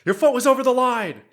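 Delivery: high, loud movie-line delivery; Dodgeball movie quote. Retold or imitated. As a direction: bark the line like a referee. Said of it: "the movie Dodgeball with the golden doily".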